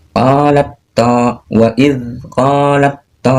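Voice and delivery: qalat to wa idh qalat to drawn out, t merged; slow qalat to, t into heavy t; slow recitation demo. Shown as a demonstration. Teacher reading the verse example slowly. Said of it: "Cara membacanya".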